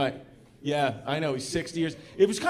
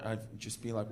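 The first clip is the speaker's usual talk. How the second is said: usual speaking voice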